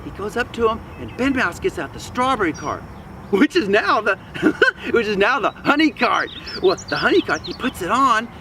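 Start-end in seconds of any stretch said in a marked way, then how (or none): 3.3-6.3 loud, chuckling narration; amused slip mid-story; real laughter. Real, not acted. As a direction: break into a laugh and restart. Narrator cracks up, restarts the phrase.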